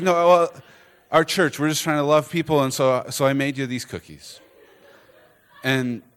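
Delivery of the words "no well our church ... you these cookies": higher, louder excited rush, easing off at the end; bubbling over to explain; eager explanation. Retold or imitated. Becky explains eagerly to him.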